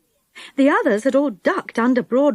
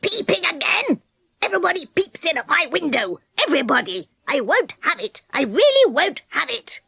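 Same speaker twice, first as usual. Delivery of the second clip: higher, indignant pixie ranting; angry pixie scolding, not much louder; furious pixie; 6 semitones higher than usual. A narrator's reading. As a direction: rage at the peeping girl. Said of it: "and yelled loudly".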